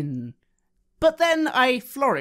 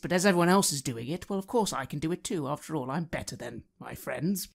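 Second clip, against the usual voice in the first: ordinary talk, nothing marked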